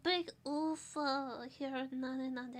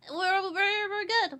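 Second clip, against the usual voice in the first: flustered; 6 semitones higher, 8 dB louder than usual. Real, not acted. Flustered reassurance after late start.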